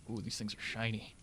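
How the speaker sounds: hushed awed murmur; soft awe. Real, not acted. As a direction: murmur in quiet wonder.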